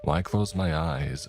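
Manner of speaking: ordinary delivery, nothing marked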